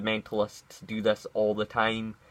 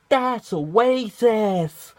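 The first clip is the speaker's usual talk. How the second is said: much higher, louder, slow outraged whine; mocking SJW; 11 semitones higher, 7 dB louder, much slower than usual. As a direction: ham up an offended activist's cry. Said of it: "as the S J Ws call".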